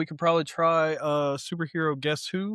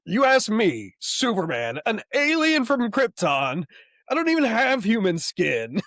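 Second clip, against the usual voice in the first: higher, emphatic comic-book declamation; hammed-up Superman; 5 semitones higher, 3 dB louder than usual. Quoting Superman's reply from the comic.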